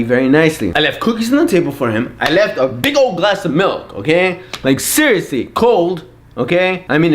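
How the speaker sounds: usual speaking voice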